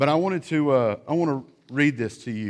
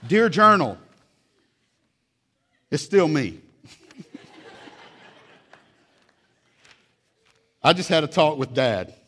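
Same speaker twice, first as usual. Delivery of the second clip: higher, louder mock child voice; hammed-up kid's journal; 3 semitones higher, 8 dB louder than usual. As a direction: read the entry like a sulky kid. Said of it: "a new entry".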